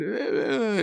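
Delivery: high drawn-out groan, imitating someone passed out; mock dazed groan. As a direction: groan as if dizzy and out cold. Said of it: "And then Pops is going".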